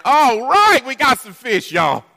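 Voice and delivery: loud, high whoop of joy; excited cheer. Retold or imitated. A fisherman cheering his haul to friends, excited and loud. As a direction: celebrate a big catch. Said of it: "He doesn't jump up and down".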